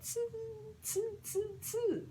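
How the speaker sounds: very high squeaky monkey tsu; monkey chatter. Acted. Monkeys mocking the peddler with high chatter. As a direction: chatter back like a cheeky monkey. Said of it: "monkeys ... said".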